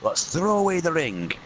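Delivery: a touch of Scottish banter as a weary hobbit; Scottish accent. Acted. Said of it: "Lord of the Rings rewritten by Scots".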